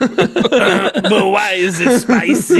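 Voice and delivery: amused high rising question; giggling through the words; laughing question. Real, not acted. Joking question.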